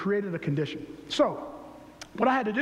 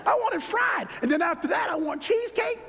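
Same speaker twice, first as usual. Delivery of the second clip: excited craving; 11 semitones higher than usual. Acted. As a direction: call out each treat like a hymn. Craving food, preacher-like.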